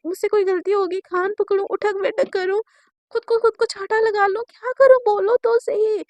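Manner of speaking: pleading build. Acted. Shaili begs escalating.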